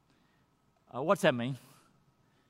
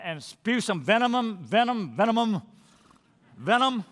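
each venom louder and higher, building up; venom repeated, building; 5 semitones higher, 4 dB louder, slower than usual. Acted. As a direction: hammer on venom, venom, venom, rising each time. Preacher warns against spewing anger.

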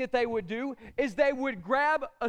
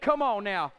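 ordinary delivery

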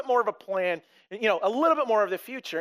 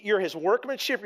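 ordinary talk

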